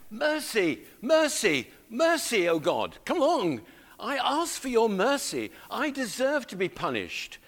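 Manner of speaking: urgent, high-pitched plea for mercy; praying as David. Acted. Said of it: "as if you were praying it for yourself".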